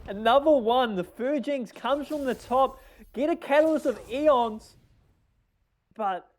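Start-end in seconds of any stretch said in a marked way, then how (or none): 0.1-4.8 higher-pitched, thrilled call of a surprise draw; excited play-by-play. Real, not acted. Surprise card draw in live commentary.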